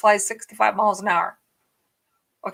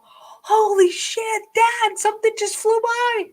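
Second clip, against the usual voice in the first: high, slow startled yelp; shocked cry; 10 semitones higher, slower than usual. Retold or imitated. Something flies past the windshield, high startled cry to dad. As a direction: gasp it out, terrified. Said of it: "And I go".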